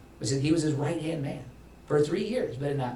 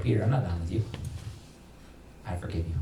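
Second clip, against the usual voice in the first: tender Jesus; 4 semitones lower, 5 dB quieter, faster than usual. Retold or imitated. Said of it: "Jesus was saying".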